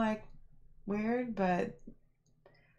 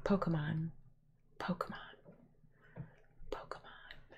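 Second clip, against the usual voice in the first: Pokemon repeated, softer each time down to a whisper; calm repeated Pokemon dying to a whisper; calm, fading away; 2 semitones lower, 9 dB quieter than usual. Shown as a demonstration. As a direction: let each Pokemon fade until only a whisper is left. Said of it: "So calm".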